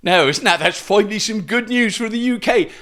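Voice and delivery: put-on West Country accent, nearer Gloucestershire; mock Somerset accent. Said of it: "that is not a Somerset accent. That is probably a Gloucestershire accent".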